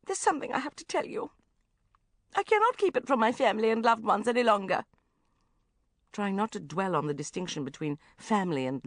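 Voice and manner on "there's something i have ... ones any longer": self-pitying mother. Acted. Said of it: "self-pitying whisper".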